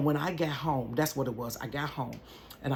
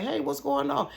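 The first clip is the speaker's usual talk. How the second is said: nervous greeting; 11 semitones higher than usual. Retold or imitated.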